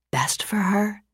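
breathy, disbelieving echo of her words; incredulous whisper. A narrator's reading. Lawyer echoes his client's claim in a stunned whisper. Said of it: "in incredulous wonder".

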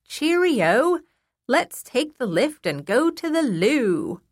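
high, hammed-up British accent, slow on the second line; British impression. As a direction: play up a silly posh British voice to make a child laugh. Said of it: "I say in my best British impression".

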